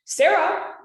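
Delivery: Sarah called high and loud, sharp. Shown as a demonstration. Teacher demoing tone, a sharp high Sarah. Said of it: "I could just say my name".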